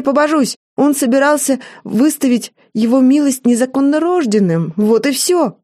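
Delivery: high, urgent protesting; the maid swearing to the ghosts, high and desperate; desperate pleading. A narrator's reading. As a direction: swear it wasn't him, desperately.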